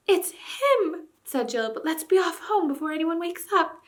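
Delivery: put-on high, gleeful girl's voice; a pleased girl hiding her triumph; Jill's girl voice. A narrator's reading. Jill boasts to the king, high girlish voice.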